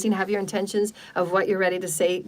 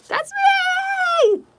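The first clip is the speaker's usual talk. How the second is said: much higher, louder, gleeful cry; excited shout; 4 dB louder than usual. Real, not acted. Healer cheering about her PayPal link, excited shout. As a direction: burst out with joy.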